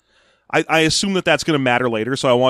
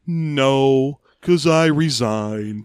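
slow, drawn-out put-on voice on resigned; a lightly voiced Han, dragging out resigned; slow deadpan; much slower than usual. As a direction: deliver it like a smug shrug, very slowly. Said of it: "He's like".

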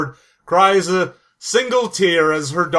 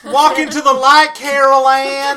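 mock movie-heroine cry; calling out; 7 semitones higher, 5 dB louder than usual. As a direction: holler it through the house. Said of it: "running around in houses going".

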